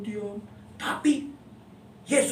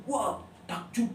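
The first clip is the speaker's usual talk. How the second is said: ordinary talk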